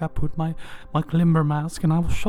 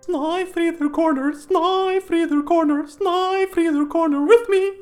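slow, higher, drawn-out show title call; show sign-off; 9 semitones higher, much slower than usual. Acted. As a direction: sing out the show name slowly, rising.

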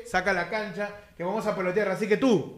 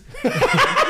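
high squeal laugh. Acted. High laugh mocking [name].

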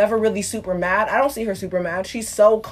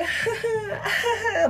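high-pitched imitated giggle; soft, high fake laugh of a TV character; mock girly giggle; 13 semitones higher, 6 dB quieter than usual. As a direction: put on a light, friendly titter. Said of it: "she was all like".

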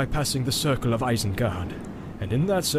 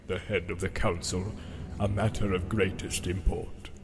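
lower, softer, slow sneering wizard; scornful Saruman; 5 semitones lower, 6 dB quieter than usual. A narrator's reading. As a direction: Saruman belittles Gandalf, low and slow scorn. Saruman voiced by narrator.

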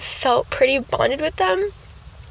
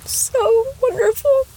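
high emotional gush; 11 semitones higher, slower than usual. Real, not acted. Overcome with joy.

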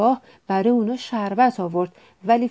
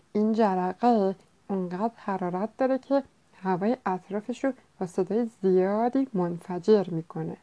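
softer explaining voice, unlike the narrator; matter-of-fact beaver; 4 dB quieter than usual. A narrator's reading. Beaver corrects friends with George's science, soft explaining voice. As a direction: explain it quietly and soberly as the beaver.

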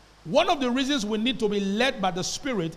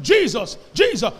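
retold scream; 3 semitones higher, 7 dB louder than usual. Terrified wife calling on Jesus while being flogged, retold scream. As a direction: cry out the name, sharp rise in volume. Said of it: "She started screaming".

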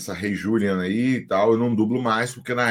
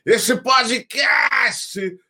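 high promo voice; 12 semitones higher, 3 dB louder, much slower than usual. Pretend.

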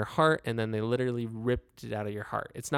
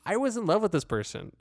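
indignant protest; 8 semitones higher than usual. Retold or imitated. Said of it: "and you're just like".

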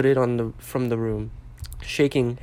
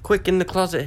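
a touch of a hiss, urgent; hurried order with some edge; 6 semitones higher, 4 dB louder than usual. A narrator's reading. Harry sends Dobby into the closet, urgent hiss. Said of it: "hissed Harry".

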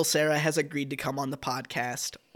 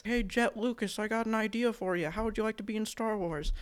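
higher, softer put-on director voice; soft, high-pitched caricature of a dad; George Lucas; 5 semitones higher, 6 dB quieter than usual. Shown as a demonstration. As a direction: imitate a filmmaker pitching softly to his son. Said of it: "he sounds exactly like this".

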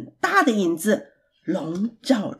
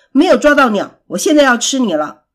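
loud, irritated threat; angry monster; 8 dB louder, faster than usual. A narrator's reading. Monster missed the bird and threatens pig, angry monster. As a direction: snap it out loudly, cross. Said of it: "怪兽生气地说".

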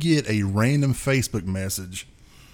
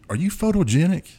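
creepy flattery; 5 semitones higher, 4 dB louder than usual. Retold or imitated. Garth flattering the drummer.

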